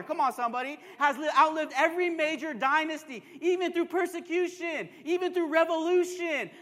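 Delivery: loud, rising declaration from the pulpit; full-force preaching. Real, not acted. Preacher declaring the church outlives every empire, full-force preaching. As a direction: proclaim it with building force.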